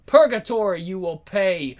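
ordinary talk

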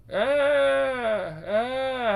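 anguished cry. Acted. Man cries out in anguish.

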